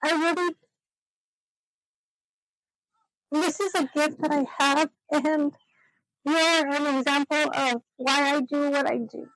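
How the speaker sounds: tearful. Real, not acted. Telling her friend she inspires her work, tearful. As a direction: push heartfelt praise through real tears. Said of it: "I said I wasn't gonna cry".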